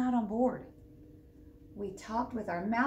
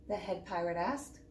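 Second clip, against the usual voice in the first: ordinary delivery, nothing marked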